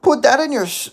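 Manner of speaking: loud, high put-on voice; attempted impression. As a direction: start the catchphrase high and punchy.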